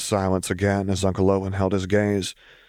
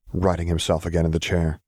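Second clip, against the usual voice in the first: ordinary delivery, nothing marked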